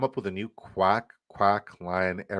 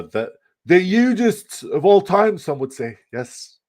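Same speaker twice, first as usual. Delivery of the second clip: high, slow, loud presidential boast; Donald Trump; 9 semitones higher, 6 dB louder than usual. Acted. Streamer naming the greatest duck ever, bad Trump impression. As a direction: do a bad, hammed-up politician impersonation. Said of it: "my Trump impression so bad".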